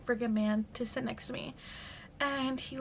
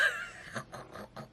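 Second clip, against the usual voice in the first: high, soft burst of laughter; amused laugh; 5 dB quieter than usual. Real, not acted. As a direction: crack up at something silly. Laughs at fiance's funny message.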